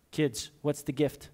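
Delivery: normal talking voice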